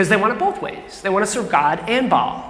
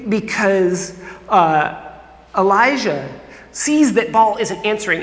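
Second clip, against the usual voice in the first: ordinary delivery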